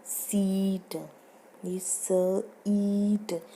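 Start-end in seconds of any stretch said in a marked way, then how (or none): 0.1-1.1 सीट with a long stretched ee. Shown as a demonstration. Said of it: "ये जो लंबी ई की आवाज़ आती है". 2.7-3.4 ईट with a loud long ee. Shown as a demonstration. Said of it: "लंबी sound है जो ई".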